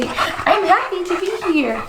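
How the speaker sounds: put-on high, slow baby voice; speaking for the baby. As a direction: voice the baby's thoughts.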